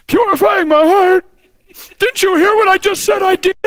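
high, whining mock-tearful protest; hammed-up wounded bewilderment; incredulous confessor. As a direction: object in disbelief through pretend tears. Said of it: "They're like".

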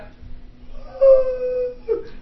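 very high drawn-out gasping wail; mock high-pitched cry; imitated wife's cry. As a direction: imitate her long high sobbing gasp. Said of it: "she went".

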